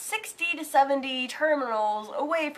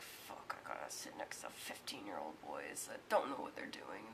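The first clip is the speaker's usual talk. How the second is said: hushed, breathy aside under the breath; whispered dread; 24 dB quieter than usual. Retold or imitated. She whispers dismay at sitting by teen boys. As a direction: whisper your dismay to yourself.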